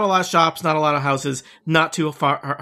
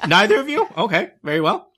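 gnome surprised; 7 semitones higher, 4 dB louder than usual. Acted.

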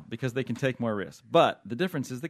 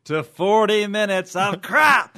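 slow furious yell, much higher and louder; Angry Regis; 9 semitones higher, 12 dB louder, much slower than usual. Acted. Co-host mocking the show's forty minutes as Angry Regis, furious slow shout. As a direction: blow up at the show like an enraged TV host. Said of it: "I did Angry Regis there".

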